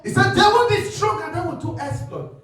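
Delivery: fiery preaching. Real, not acted. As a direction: preach it out with full force. Preaching at full force.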